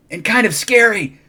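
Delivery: delighted little fright; thrilled. Acted. Child thrilled and scared.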